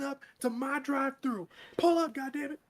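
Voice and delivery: high, loud mock cashier scolding; drive-thru worker. Cashier scolding a regular customer, high and loud. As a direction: yell at the customer like a fed-up cashier.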